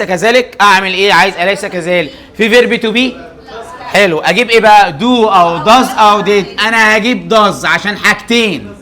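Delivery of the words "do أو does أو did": options drawn out one by one; slow listing. Shown as a demonstration. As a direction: spell out each choice slowly for the students. Teacher listing the helper verbs. (عدّ الاختيارات ببطء واحدة واحدة)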